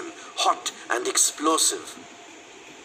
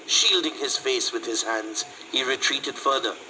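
plain reading voice

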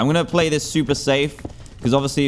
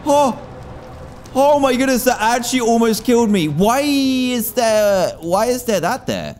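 panicked shock; 11 semitones higher than usual. Real, not acted. Player nearly killed by lava, panicked and high-pitched. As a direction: cry out high in panicked disbelief.

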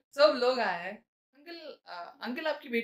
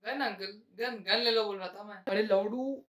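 ordinary talk

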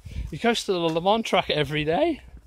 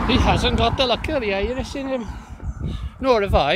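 loud, high stage Irish; hammed-up Irish lilt, pitched up; mock Irish accent; 8 semitones higher, 5 dB louder than usual. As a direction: belt it out in a bad Irish accent. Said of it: "my Irish accent is so bad".

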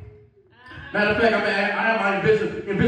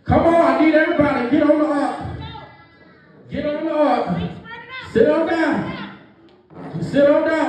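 calling out as Noah; 5 semitones higher than usual. Acted. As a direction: round everyone up before the rain. Plays Noah calling people aboard.

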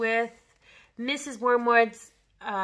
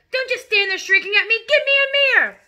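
high, loud panicked yell; building to a piercing demand; yelling father; 11 semitones higher, 10 dB louder than usual. Acted. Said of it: "the father yelled".

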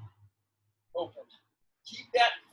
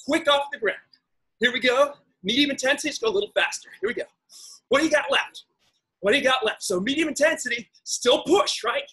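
aerobics instructor; 4 semitones higher than usual. Acted. Pushes the class through intervals.

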